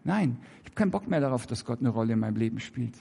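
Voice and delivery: leiser, hastig wiederholt. Shown as a demonstration. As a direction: repeat it hushed and quick, a little sheepish. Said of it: "Hä?".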